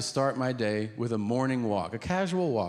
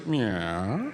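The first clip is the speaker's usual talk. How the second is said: odd drawn-out reply; 3 semitones lower than usual. Retold or imitated.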